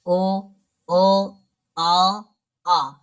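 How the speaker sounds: teacher demonstrating rounded vowels; rounded vowel demo. Shown as a demonstration. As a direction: boom out ओ with round lips. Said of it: "होंठ व्रतमुखी या गोलाकार होते हैं".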